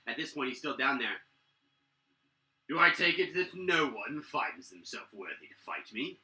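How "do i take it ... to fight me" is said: villain taunting the keep's defenders; half-dragon warlord. Acted. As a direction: gloat down at the defenders. Half-dragon challenges again.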